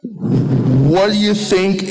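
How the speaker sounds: normal talking voice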